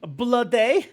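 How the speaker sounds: high, loud Scottish bloody; Scottish accent. Shown as a demonstration. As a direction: roll it out like a hammy Scot. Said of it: "like, a Scottish accent".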